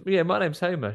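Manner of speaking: a hint of a cheeky boy; little boy voice. Homer introducing himself, lightly voiced boy. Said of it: "He goes".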